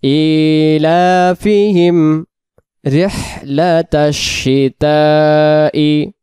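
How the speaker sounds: slow drawn-out tajweed recitation; measured Quranic chant. Shown as a demonstration. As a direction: recite each word slowly and carefully. Teacher recites Quran verse slowly.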